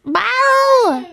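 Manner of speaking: loud, high mock yowl; cat yowl imitation. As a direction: yelp like an upset cat. Mimicking the crying cat.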